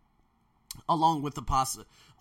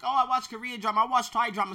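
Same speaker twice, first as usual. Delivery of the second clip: mock students; 9 semitones higher, 7 dB louder, faster than usual. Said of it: "my students are like".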